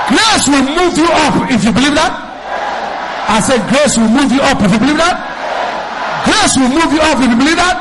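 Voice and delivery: loud, high, ringing pulpit cry; fiery sermon climax; full-force preaching. Acted. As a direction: preach it to the back row.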